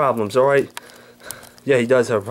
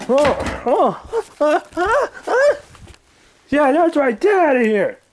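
Angry Grandpa voice; 13 semitones higher than usual. Acted. Old man woken by pranksters, high cranky yelling. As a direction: bark at the intruders like a furious grandpa.

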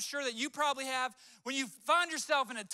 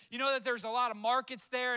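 ordinary delivery

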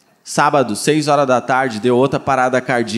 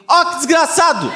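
higher, exasperated cry of outrage; mock outrage; 12 semitones higher than usual. Real, not acted. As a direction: blurt out a mock curse at the vet. Outraged exclamation about vet. (indignação fingida, mais aguda)

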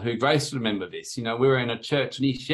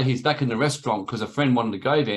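ordinary talk, nothing marked

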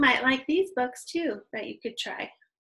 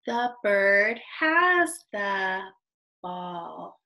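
slow, drawn-out beginner reading aloud; careful, stretched read-aloud; slow model reading; 2 semitones lower, much slower than usual. Shown as a demonstration. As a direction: read word by word for new readers.

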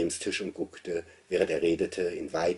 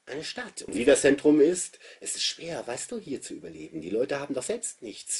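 put-on boy's voice; 9 semitones higher, 5 dB louder than usual. A narrator's reading. Narrator voices the boy Andreas.

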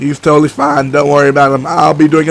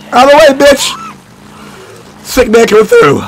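raised, high-pitched shout; loud, high playful warning; loud call-out; 10 semitones higher, 5 dB louder than usual. Pretend. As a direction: yell to clear the path. Calling out while riding through enemies.

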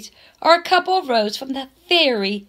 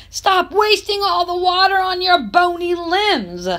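higher, scolding grandpa voice; gruff grandfather; 7 semitones higher than usual. A narrator's reading. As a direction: play the grumpy granddad nagging. Grandfather scolding through bathroom door.

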